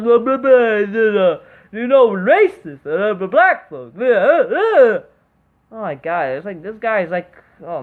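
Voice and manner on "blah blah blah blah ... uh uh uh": louder mock voice, dragging the words; mocking imitation. Mocking the suspect's talk about racism, in a sneering voice. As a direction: parody his whining, sneering.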